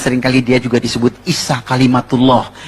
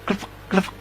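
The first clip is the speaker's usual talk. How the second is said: usual speaking voice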